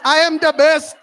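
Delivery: loud, proud "I am the best"; with confidence. Retold or imitated. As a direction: say it with full confidence. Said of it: "Say it with confidence".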